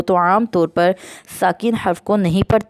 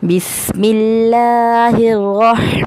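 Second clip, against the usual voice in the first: slow, drawn-out chanted recitation; Quran recitation; much slower than usual. Acted. Teacher opening the lesson for listeners, slow chanted recitation. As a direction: intone each word slowly and reverently.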